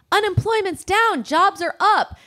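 high, slow, mock-cheery announcement; upbeat news voice. News people selling the economy to the public, mock-upbeat report. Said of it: "They're like".

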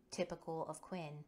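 plain reading voice

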